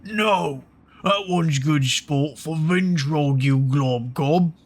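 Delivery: two-headed giant. Acted. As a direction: drag it out like a dim brute. Voicing the giant's other head.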